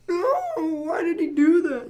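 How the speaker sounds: put-on high whining little kid; whiny kid voice. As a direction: whine it like an upset toddler. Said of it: "They're like".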